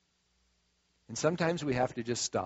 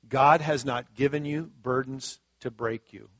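ordinary delivery

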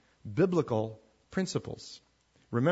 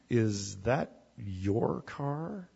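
slow and softer, each word drawn out with judgment; loaded, disapproving question; 5 semitones lower, 7 dB quieter, much slower than usual. Shown as a demonstration. Someone looking down on your car, slow and disapproving. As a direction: ask it slowly, as if the car is a disgrace. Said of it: "But if they said".

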